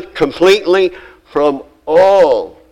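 all stretched and punched, slowed down; emphasis on all. Real, not acted. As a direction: lean hard on the word all. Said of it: "I emphasize the word all".

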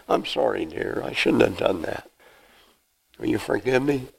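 soft, low sorry to his wife; sheepish apology. Retold or imitated. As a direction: hang your head and apologize gently.

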